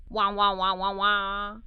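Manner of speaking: low, far-off wah-wah sound like a spinning lid; wah-wah trombone imitation; funky wah noise. A narrator's reading. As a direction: mimic a wobbling pot lid, low and droning. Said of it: "it made a funky noise".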